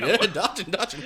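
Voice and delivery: high, giggling dodging; laughing mock voice. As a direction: chuckle through the words. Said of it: "she had, like".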